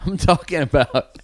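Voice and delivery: giggling through the words; laughing. Real, not acted. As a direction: talk while cracking up. Laughing while explaining.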